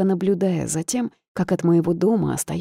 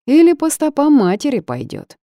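louder, higher leering jeer; leering sneer; 9 semitones higher, 7 dB louder than usual. A narrator's reading. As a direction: smirk it out crudely. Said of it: "Скабрезно усмехнулся какой-то оплывший и небритый мужик".

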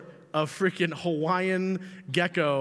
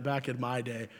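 a hint of a grumpy old man; mock old-timer; 5 semitones lower, 5 dB quieter than usual. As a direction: say it like a crusty elder. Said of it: "I feel like saying that".

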